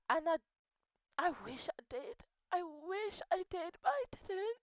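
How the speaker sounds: crying, pitch rising with regret; real tearful regret. Real, not acted. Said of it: "why am I crying".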